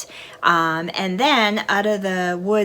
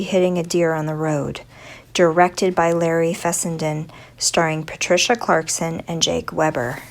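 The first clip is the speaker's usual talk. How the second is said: louder, announcer-like read; formal synopsis read; 5 dB louder than usual. Acted. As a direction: read it like a blurb. Reads film synopsis.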